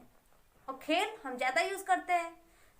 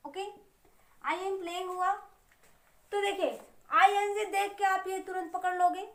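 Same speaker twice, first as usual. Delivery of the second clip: ordinary talk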